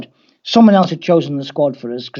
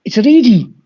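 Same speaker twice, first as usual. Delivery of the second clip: high, louder Scouse announcement; Scouse accent; 6 semitones higher, 5 dB louder than usual. Retold or imitated. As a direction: announce yourself in lifted Scouse. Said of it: "the Scouse accent".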